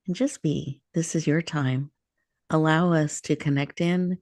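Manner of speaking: soothing meditation guide; guided meditation. Acted. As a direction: ease listeners into stillness and breath.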